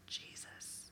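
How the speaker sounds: breathless whisper of Jesus; whispered name. Shown as a demonstration. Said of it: "Oh, I felt a little something".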